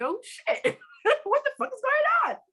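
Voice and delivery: high, laughing shock at the hang-up; shocked disbelief. Retold or imitated. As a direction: squeal it out, half laughing, totally thrown. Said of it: "So I'm like".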